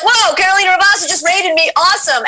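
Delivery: high, excited mock exclamation; excited reaction. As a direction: gush like a surprised streamer. Imagines streamer's reaction to being raided.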